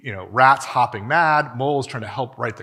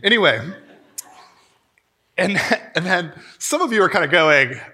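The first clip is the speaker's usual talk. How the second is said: usual speaking voice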